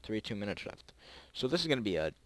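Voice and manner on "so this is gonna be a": ordinary delivery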